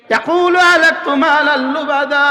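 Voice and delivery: Quranic recitation. Acted. Preacher to congregation, reciting the verse in slow chant. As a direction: draw out the Arabic verse in melodic tone.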